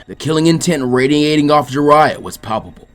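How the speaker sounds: plain reading voice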